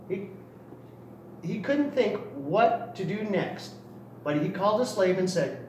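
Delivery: plain reading voice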